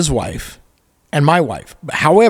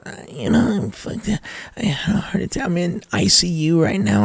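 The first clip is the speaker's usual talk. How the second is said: hushed, higher, feeble imitation of a patient; mock sickly voice; 4 semitones higher, 5 dB quieter than usual. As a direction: put on a weak, breathy sick-bed whisper. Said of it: "he's talking like this".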